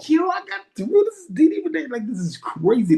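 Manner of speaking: high, breathless disbelief; starstruck caller. Retold or imitated. Caller starstruck at talking to the DJ. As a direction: gush like you can't believe she answered. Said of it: "I was like".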